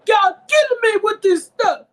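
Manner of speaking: high, loud hammed-up crying complaint; mock wail. Real, not acted. Narrator fed up with commercials wails in a loud mock cry. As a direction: wail about the ads in mock despair.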